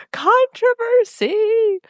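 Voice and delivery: high, long-drawn singsong glee; hammed-up delight, stretching every syllable; giddy. Podcaster imitating Mia relishing town meeting controversy, gleeful. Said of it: "real excited about the controversy".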